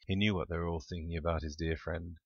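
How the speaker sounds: usual speaking voice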